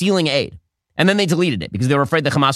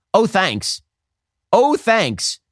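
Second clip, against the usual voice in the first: mock gratitude, drawn out on "oh"; sarcastic thanks; 4 semitones higher, much slower than usual. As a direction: repeat it higher the second time, dripping with irony. Mock gratitude for an empty promise.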